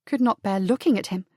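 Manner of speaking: plain reading voice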